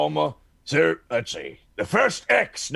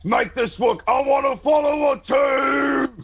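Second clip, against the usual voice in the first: shout; 8 semitones higher than usual. Acted. Player shouts before the roll.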